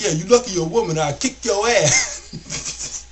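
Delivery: mock threat, drawn out at the end; pissed. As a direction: imitate an angry customer, stretching the last words. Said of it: "he's pissed at this point".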